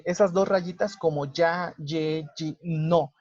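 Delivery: usual speaking voice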